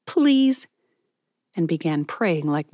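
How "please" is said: high squeaky "please"; squeaked plea. A narrator's reading. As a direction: squeak it out, pleading. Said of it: "just squeaked out a".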